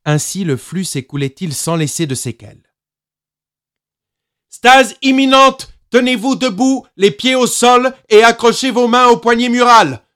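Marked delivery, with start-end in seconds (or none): 4.5-10.0 loud, high public-address order; loudspeaker announcement. A narrator's reading. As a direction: blare it like a ship's speaker at full volume. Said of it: "ordonnèrent les haut-parleurs réglés à la puissance maximale".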